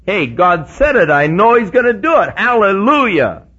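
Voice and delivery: loud, confident declaration; Abraham's faith. Retold or imitated. Pastor voicing Abraham's faith to the church, loud and sure. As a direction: shout your trust in the promise. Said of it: "Part of his faith was".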